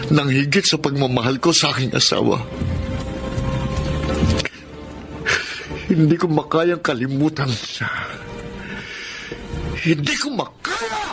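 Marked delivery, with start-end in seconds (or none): 5.9-11.0 building anguish. Acted. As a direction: start pained, climb sharply into a desperate wail. Anguished man cannot forget her.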